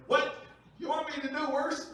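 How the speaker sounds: put-on high, loud incredulous kid; loud, puzzled child pushing back; kid's baffled disbelief. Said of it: "And they're like".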